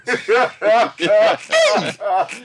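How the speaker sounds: a high burst of real laughter; bright peal of laughter; hearty laugh. Real, not acted. Laughs at own joke.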